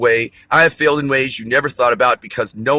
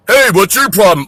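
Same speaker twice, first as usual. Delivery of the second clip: confrontational yell; 8 semitones higher, 9 dB louder than usual. Retold or imitated. People confronting him.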